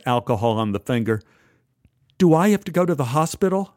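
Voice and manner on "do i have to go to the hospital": worried. Acted. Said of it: "Barry asked".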